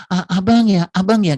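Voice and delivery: high, loud, gushing longing wife; wife who missed her husband all day. Retold or imitated. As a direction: pour out how much she missed him. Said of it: "Udah kangennya luar biasa".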